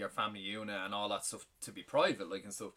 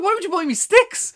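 outraged disbelief; 16 dB louder than usual. Retold or imitated. Retold outraged question to dad.

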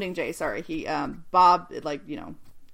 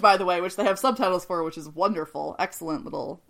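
ordinary delivery

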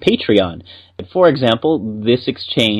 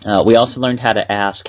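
normal talking voice

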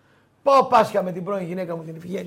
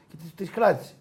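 ordinary talk

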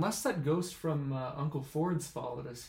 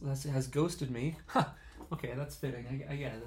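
ordinary talk

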